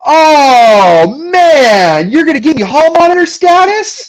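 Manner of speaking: loud, high, outraged cry of disbelief; mock outraged shock. Real, not acted. Friend calls him a hall monitor, he yelps in mock outrage. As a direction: play it like a wounded gut punch, loudly.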